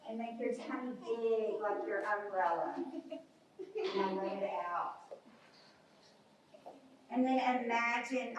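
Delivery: soft, hushed relaxation voice; calming cool-down. Acted. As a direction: lull the kids to rest. Winds down to relaxation.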